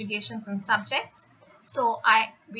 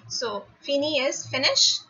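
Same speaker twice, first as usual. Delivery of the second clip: normal talking voice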